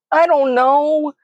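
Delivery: high, drawn-out wail; slow, high-pitched helpless protest; sputtering distress. A narrator's reading. Terrified child answers dad, embarrassed sputtering wail. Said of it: "I sputter".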